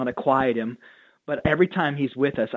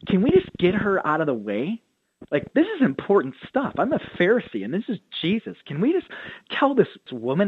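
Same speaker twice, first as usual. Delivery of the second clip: higher, indignant, self-important whine; haughty Pharisee; 6 semitones higher than usual. Retold or imitated. Pastor voicing a Pharisee annoyed by a woman at dinner, lightly indignant and higher. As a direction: brush the woman aside as beneath you. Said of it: "the Pharisee's like".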